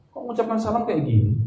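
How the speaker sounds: high, baffled asking; puzzled question. Retold or imitated. Locals puzzled by the salam, high-pitched wondering. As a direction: wonder aloud at the strange greeting. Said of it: "Orang sana malah heran".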